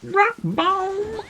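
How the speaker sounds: very high, squeaky little cry. Pretend. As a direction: squeak it like a tiny cartoon critter. Squeaky call repeated.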